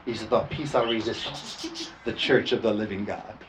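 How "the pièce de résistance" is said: put-on a tentative French-accented phrase; attempted French. Pastor trying French before a French speaker, French accent. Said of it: "Did I say that right? She speaks French".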